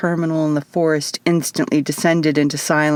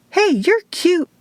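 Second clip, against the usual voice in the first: much higher, sweet cooing to a small animal; girl cooing at a cute bat, much higher; 13 semitones higher than usual. A narrator's reading. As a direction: talk to the bat like a baby. Izzy coos at tiny bat.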